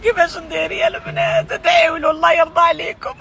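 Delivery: crying and shouting in distress; wailing plea, voice breaking; angry crying. Real, not acted. Woman pleading for injured brother.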